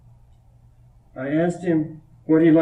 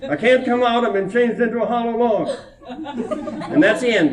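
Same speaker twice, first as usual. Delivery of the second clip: put-on higher, small trapped-boy voice; trapped little boy; 7 semitones higher than usual. Voicing the boy stuck inside the log, small and high.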